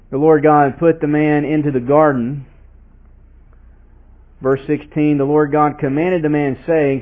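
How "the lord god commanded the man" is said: ordinary delivery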